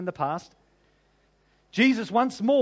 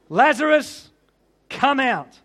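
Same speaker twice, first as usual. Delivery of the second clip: louder and higher call to come out; loud command; 3 semitones higher, 6 dB louder, slower than usual. A narrator's reading. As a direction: command him from the grave. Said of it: "in a loud voice".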